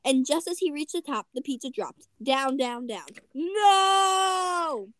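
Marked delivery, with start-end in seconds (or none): 3.3-4.9 long, high, loud wail; drawn-out dramatic cry; anguished shout. A narrator's reading. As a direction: hold the shout out as disaster falls. Froggy as the pizza drops.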